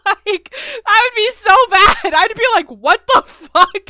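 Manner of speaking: loud, high mock outrage through laughter; laughing outburst. Imagining a friend laughing while you sob, laughing outburst.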